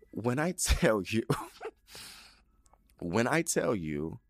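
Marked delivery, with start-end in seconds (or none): 0.1-1.2 amused, giggle mid-phrase; laughing. Real, not acted. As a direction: laugh breaking into the words. Laughs while starting her point.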